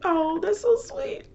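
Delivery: very high, slow coo; re-enacted high-pitched "aww" reaction; touched, gushing reaction. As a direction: coo it sweetly, drawn out. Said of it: "I was like".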